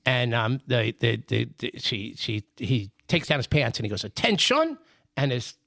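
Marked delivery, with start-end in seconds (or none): 4.0-4.8 high, rising call to attention; military command. Acted. Soldier husband ordering his body in a joke, military command. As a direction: call out a mock drill order.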